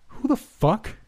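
high-pitched angry outburst; acted indignant yell, pitched right up. A narrator's reading. Tanner startled in the testing room, high angry yell. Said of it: "Tanner yelled in an angry tone".

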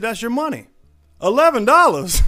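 high, loud mock boss. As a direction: bark the number like it's generous. Boss hands out tiny check.

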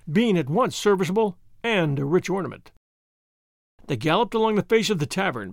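usual speaking voice

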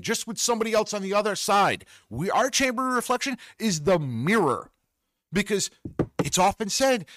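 high, pushed and heated rant; fired up. Real, not acted. Ranting to viewers about confession and the mirror, high and heated. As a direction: let the rant climb until it peaks. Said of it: "I'm getting fired up".

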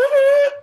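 sudden very high, loud mock wail; imitated shocked wail. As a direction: shriek out her reaction, imitating her. Imitates Rachel's reaction on reaching his page.

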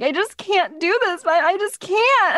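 high mock-tearful plea; retold sobbing. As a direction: imitate breaking down in helpless sobs. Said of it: "just sobbing uncontrollably".

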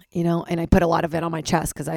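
usual speaking voice

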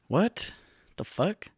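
quiet, high-pitched rising disbelief; baffled disbelief. Retold or imitated. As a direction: ask it softly, voice lifting on each part. Baffled reaction to a discovery.

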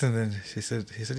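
ordinary delivery, nothing marked

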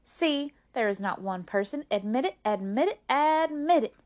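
building to a high, loud demand; insistent cry. Acted. May May demanding her sister admit it, insistent cry. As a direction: press harder with each repeat. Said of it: "cried May May".

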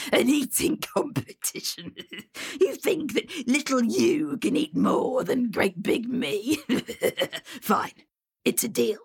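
whispery, amused troll; troll scoffing at the tiny challenger; laughing troll. Acted. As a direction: chuckle at the little boy's dare.

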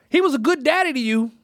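high, emphatic grandma voice; imitated old lady, high and insistent; elderly grandmother. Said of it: "this is a ninety-three-year-old woman".

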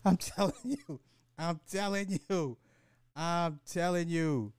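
high-pitched insistence; high emphatic voice. Acted.

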